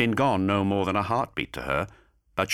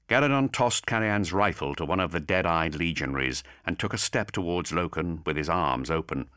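usual speaking voice

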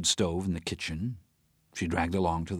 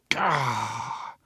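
short guttural frustrated grunt; guttural gah; 7 semitones higher, 3 dB louder than usual. Acted. Teresa blows off steam, a guttural gah. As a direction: let the steam out in one throaty burst. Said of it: "let off some steam with a guttural".

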